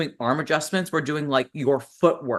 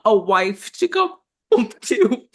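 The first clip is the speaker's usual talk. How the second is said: high, slow, on the edge of tears; choked-up, slow confession; tearful retelling; 6 semitones higher, slower than usual. Retold or imitated. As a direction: let the voice catch as she tells it. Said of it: "And I was like".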